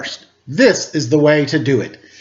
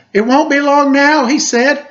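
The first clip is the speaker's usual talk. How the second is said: high, raised voice calling out; calling after; 12 semitones higher, 4 dB louder than usual. A narrator's reading. Linus calling to Sally as she leaves, high raised call. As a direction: call it after someone walking away. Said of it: "Linus called after her".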